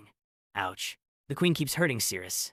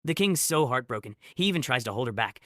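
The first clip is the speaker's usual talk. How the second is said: ordinary delivery, nothing marked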